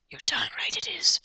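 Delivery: high unvoiced whisper on "you're darn right it is"; whispered retry. Shown as a demonstration. Trying out the inspector's voice, whispered high. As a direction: try the line again in a whisper. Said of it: "what's his voice?".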